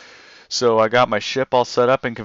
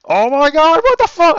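a sudden shout, far higher and louder; shocked outburst; 13 semitones higher, 5 dB louder than usual. Real, not acted. Gamer hit by an unexpected boss attack, shocked shout. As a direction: explode in disbelief.

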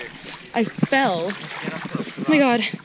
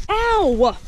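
sharp ow; 8 semitones higher than usual. Real, not acted. Bug hits her face.

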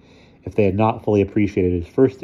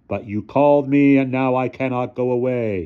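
eerie figure; 6 semitones higher, 4 dB louder than usual. Acted. Spectral figure speaking.

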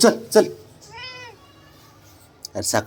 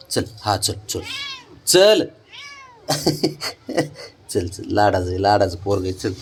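soft doting; 8 semitones lower, 5 dB quieter than usual. Real, not acted. Doting on pet.

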